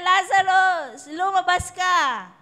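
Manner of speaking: slow, high, loud cry; commanding Lazarus to come out with a loud cry. Acted. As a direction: summon the dead man from the tomb. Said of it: "he cried with a loud voice".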